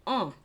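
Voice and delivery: un said as a nasal vowel. Shown as a demonstration. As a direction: say un through the nose. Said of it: "In the middle of danser is".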